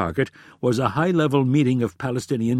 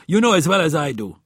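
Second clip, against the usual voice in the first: louder, higher, angry retort; spat out; 6 semitones higher, 5 dB louder than usual. A narrator's reading. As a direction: spit the words out at a colleague. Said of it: "spat Friedman".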